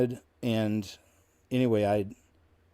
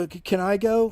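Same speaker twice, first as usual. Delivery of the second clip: higher-pitched, needy imitation; imitated eager pleading; 9 semitones higher, 4 dB louder than usual. Clingy roommate asks to tag along, mock eager. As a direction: mimic a clingy hanger-on angling for an invite.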